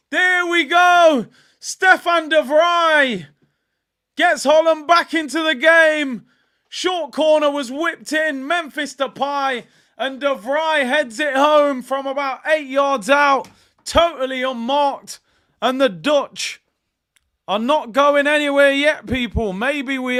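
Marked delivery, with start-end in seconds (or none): 0.1-1.3 loud, high, slow goal commentary; bellowed play-by-play; excited commentary. Real, not acted. 1.6-3.3 scorer's name bellowed slowly and high; stretched roar of "Stefan de Vrij"; shouted name. Real, not acted. 4.2-19.0 loud, high, slow goal commentary; bellowed play-by-play; excited commentary. Real, not acted.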